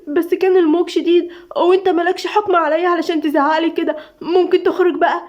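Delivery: high, tearful protest in broken bursts; sobbing, broken speech. A narrator's reading. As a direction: protest through heavy sobs. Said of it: "بتتكلم بتقطيع من كتر العياط".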